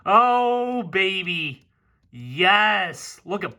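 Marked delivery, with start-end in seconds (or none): none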